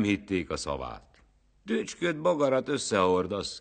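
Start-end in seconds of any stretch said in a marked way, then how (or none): 1.6-3.6 high, loud jeering call; mocking shout. A narrator's reading. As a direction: shout it at him, scoffing. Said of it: "kiáltották".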